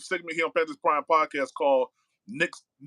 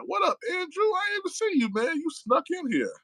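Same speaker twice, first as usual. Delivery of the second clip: high squeaky put-on voice greeting a friend; playful greeting. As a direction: pitch way up, teasing "caught you sneaking in". Greeting a viewer who joined chat.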